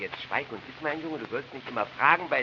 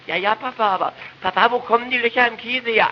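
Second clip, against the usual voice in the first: put-on high, louder childish whine; nagging boy; 12 semitones higher, 8 dB louder than usual. Boy nagging father about cheese holes, high and loud. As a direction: keep nagging papa like a little boy.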